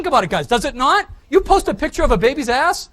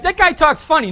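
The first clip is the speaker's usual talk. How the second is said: higher, louder mocking the audience; 5 semitones higher, 6 dB louder than usual. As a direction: tease them as if they said it. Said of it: "You're like".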